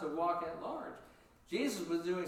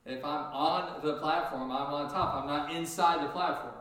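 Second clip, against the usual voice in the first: ON pressed louder; stress on on; 4 dB louder than usual. Shown as a demonstration. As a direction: press the word on, as in on top. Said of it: "I don't want to be kindergartenish".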